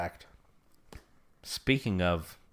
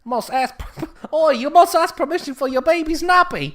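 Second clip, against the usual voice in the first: high, hammed-up British accent; mock British accent; 11 semitones higher than usual. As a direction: play a cheeky British voice, pitched up. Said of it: "Yeah, British".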